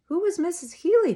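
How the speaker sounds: high, loud worried question; father demanding to know who. A narrator's reading. Father asks daughter about dead woman, worried.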